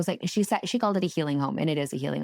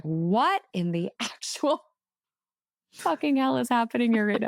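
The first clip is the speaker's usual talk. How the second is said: stunned disbelief; 5 semitones higher than usual. Retold or imitated. Seeing Brown Jesus above the fireplace, shocked disbelief. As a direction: replay the jaw-drop moment of seeing the picture. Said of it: "'cause I was like".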